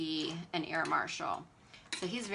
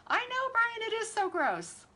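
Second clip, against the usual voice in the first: high, loud exclamation; loud, high-pitched "so gross" outburst; emphatic disgusted agreement; 6 dB louder than usual. Real, not acted.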